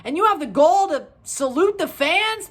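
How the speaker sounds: ordinary talk, nothing marked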